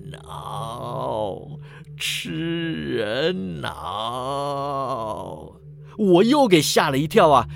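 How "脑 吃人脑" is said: hoarse, very slow undead moan; hoarse zombie. A narrator's reading. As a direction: drag each word out in a hoarse, hungry zombie voice. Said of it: "哑着嗓子说". (哑着嗓子的僵尸，拖长低吟)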